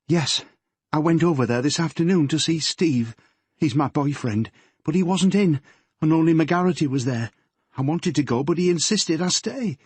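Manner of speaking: put-on nervous girl confessing to her father; teenage daughter Yvonne.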